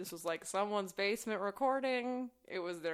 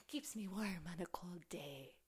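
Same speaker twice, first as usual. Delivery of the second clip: hushed, slow, vaguely Irish whisper; almost Irish accent; 2 semitones lower, 10 dB quieter, slower than usual. Retold or imitated. Woman answering about her sweater, in a near-Irish accent. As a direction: whisper it in a weird mock-Irish lilt. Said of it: "in this weird, like, almost Irish accent".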